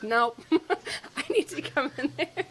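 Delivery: high, laughing "nope, I'm coming in"; laughing insistence. Retold or imitated. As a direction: push through giggles, mock urgent. Retelling insisting to midwife.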